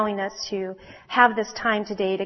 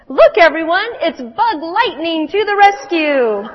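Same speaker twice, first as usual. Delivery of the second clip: higher, louder mocking jeers; taunting bugs; 7 semitones higher, 10 dB louder than usual. Mosquitoes and beetles teasing the firefly, loud mocking taunts. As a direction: jeer at him like playground bullies. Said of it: "taunting him".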